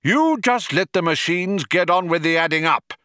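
high, indignant caution; warning. A narrator's reading. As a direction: warn them off your territory. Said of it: "warned Magic Thighs".

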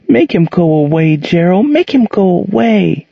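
put-on high, scared child pleading; a terrified young girl begging; frightened little girl. A narrator's reading.

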